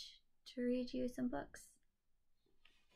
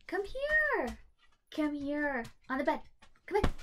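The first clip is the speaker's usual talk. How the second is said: calling the dog; 6 semitones higher than usual. Real, not acted. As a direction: sing out the command to coax him up. Calling her dog onto bed.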